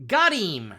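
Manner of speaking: loud "Got him" with "him" said "eem". Retold or imitated. Imitating a broadcaster's way, "Got him" as got-eem. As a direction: drop the h and stretch "him" to eem. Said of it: "as if it's spelled apostrophe E E M".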